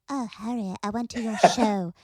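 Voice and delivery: a hint of a breathy ghost girl. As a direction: sisters joking in a ghostly voice. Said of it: "We'd be like".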